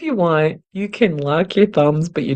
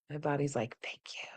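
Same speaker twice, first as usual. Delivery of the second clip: much softer, breathy whispered relief; relaxed whisper; 17 dB quieter, faster than usual. Real, not acted. Teacher relaxing during butterfly hug, whispering relief. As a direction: let the body sigh its thanks. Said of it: "there came the sigh".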